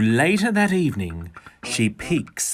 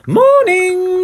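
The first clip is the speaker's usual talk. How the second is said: very high, louder sing-song greeting; Dora's cheery morning; 8 dB louder than usual. A narrator's reading. Dora greeting her tired hens, high and loud. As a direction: trill it out brightly. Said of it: "says Dora".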